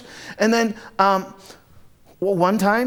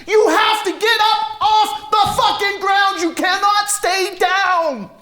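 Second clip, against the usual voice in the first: slow, high screaming, word by word; enraged scream; 4 dB louder, slower than usual. Acted. Enraged brother kicks Les on the ground, full scream. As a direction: scream the order with everything you have. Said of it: "it just makes me angrier".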